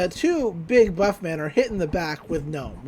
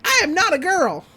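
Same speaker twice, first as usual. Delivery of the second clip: high, loud offended protest; hammed-up indignant outburst; mock indignation; 11 semitones higher, 6 dB louder than usual. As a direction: insist on it like an insulted cartoon child. Acts Gideon's indignant denial.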